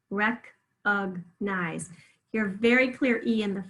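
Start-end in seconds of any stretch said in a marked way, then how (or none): 0.1-1.9 Recognize very slowly as "rec-ug-nize"; syllable by syllable. Shown as a demonstration. Teacher repeats so pupils hear the schwa, syllable by syllable. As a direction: break Recognize into three slow syllables. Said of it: "Where do you hear it?".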